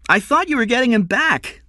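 put-on high, loud child's complaint; small boy. Young son reproaching his father, high child voice. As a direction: protest like a let-down little boy.

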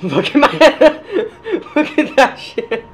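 slow, high laughing boast. Real, not acted. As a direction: laugh while showing off. Laughing pride at progress.

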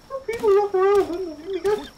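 very high, loud squeal; piercing, excited falsetto outburst; high-pitched squeal. Real, not acted. Reacting to strangers walking around.

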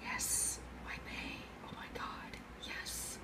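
breathless excited whisper; fangirl whisper. Retold or imitated. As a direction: gush it under your breath. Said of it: "and I'm like".

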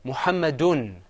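"Muhammadun" loud, ending in a clear un; teacher modelling "Muhammadun"; model pronunciation. Shown as a demonstration. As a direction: project "Muhammadun" for repeating. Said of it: "Say it with me".

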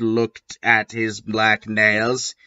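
ordinary delivery

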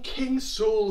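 usual speaking voice

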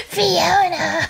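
high drawn-out creepy call; creepy old man voice. Retold or imitated. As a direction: call her name like the monster. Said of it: "that's literally what he sounds like".

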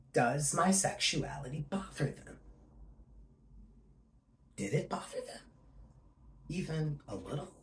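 suddenly very soft, with long pauses; hurt, hushed questioning. Acted. Rejected pastor wondering if it bothers them, hushed. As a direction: let the question drop almost to a whisper. Audition monologue, doubting the church's acceptance.

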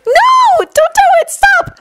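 extremely high squealed protest; panicked pleading. Acted. Shinobu watches Jun threaten her cards, squealing in panic.

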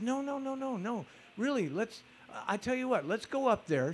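high-pitched, soft pleading insistence; earnest persuading. Retold or imitated. Talking a skeptical old lady into going, earnest persuading. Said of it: "very skeptical".

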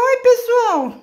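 very high, loud squeaky dog voice; voicing the dog. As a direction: make the dog greet everyone, slowly. (voz fininha do cachorro dizendo oi)

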